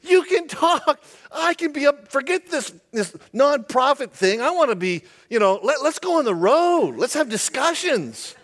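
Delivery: high, eager rush of ideas; excited. Retold or imitated. Balaam thrilled his donkey talks, high and excited. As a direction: pitch it up like a giddy schemer. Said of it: "Why is he not going".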